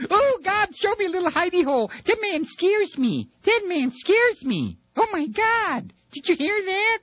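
very high squeaky frightened voice; scared weasel. Acted. The weasels hearing the speech, frightened squeal. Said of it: "it's got all the weasels going".

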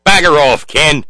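loud Aussie brush-off; cheesy Australian accent. Acted. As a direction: send him packing in a hammed-up Australian accent. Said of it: "my cheesy Australian accent".

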